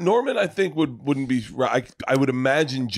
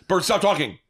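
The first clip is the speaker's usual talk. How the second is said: imitated scolding; 7 semitones higher than usual. Imitates co-host interrupting him.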